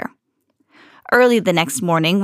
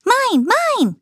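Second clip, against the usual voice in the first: high squeaky troll cry; clamoring trolls; 14 semitones higher than usual. A narrator's reading. Trolls fighting over gifts, squeaky and grabby. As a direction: grab and claim it like a greedy troll. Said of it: "they clamored".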